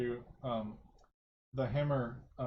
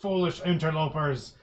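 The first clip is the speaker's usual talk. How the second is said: slow, louder lich threat; loud booming voice; 2 semitones higher, 7 dB louder, slower than usual. Acted. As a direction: boom it like an undead king. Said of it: "in his loud booming voice".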